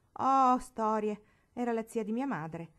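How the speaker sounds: slow, loud, airy scoff on oh storie; offhand dismissal. Acted. Barbara shrugging off Marigold's reverence, airily dismissive. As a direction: brush it off breezily as a spoiled girl. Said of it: "fece con disinvoltura Barbara".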